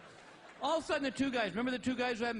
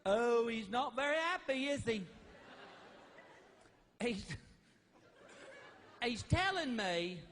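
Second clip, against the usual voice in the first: mock pet psychic, drawn out with pauses; put-on woman's psychic voice. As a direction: play a psychic receiving a dog's thoughts. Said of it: "this woman went just like this".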